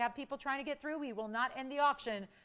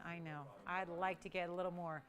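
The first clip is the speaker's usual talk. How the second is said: quiet aside; 6 semitones lower, 9 dB quieter than usual. Real, not acted. Quiet aside about price.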